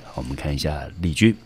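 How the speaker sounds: normal talking voice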